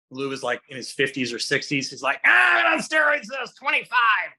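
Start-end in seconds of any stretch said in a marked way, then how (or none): 2.2-4.3 high, loud, rough shouted impression; Louis Simmons voice. Louis Simmons answers about steroids, in a high loud gruff impression. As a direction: bark it like a grizzled old powerlifter. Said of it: "Louis talked like this".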